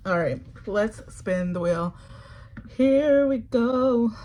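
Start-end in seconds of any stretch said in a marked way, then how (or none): 2.8-4.1 drawn-out hype. Real, not acted. Spinning the wheel.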